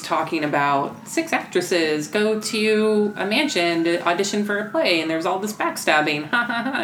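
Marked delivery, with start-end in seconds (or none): 6.3-6.8 higher, put-on ha-ha-ha; mock a comedian laughing at their joke; fake stage laugh. Mimicking a stand-up routine's laughter.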